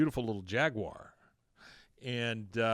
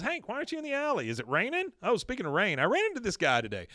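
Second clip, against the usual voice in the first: quick, high imitation of a wife; acted breezy chatter; chatty wife; 9 semitones higher, faster than usual. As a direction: rattle on into an anecdote. Acting out Peggy's chatty questions.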